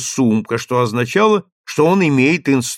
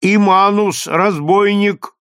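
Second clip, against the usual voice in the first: slow, loud and higher, like a trumpet; booming crier; 7 semitones higher, 4 dB louder, slower than usual. A narrator's reading. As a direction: boom each word out like a trumpet. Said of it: "гремел теперь как труба".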